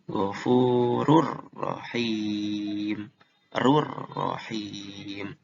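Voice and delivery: "ghafurur rahim" very slow, N merged into a rolled R; tajwid demo. Shown as a demonstration. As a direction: draw out "ghafurur rahim" with a doubled R. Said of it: "Kalau huruf ro".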